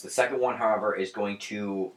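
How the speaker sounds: ordinary talk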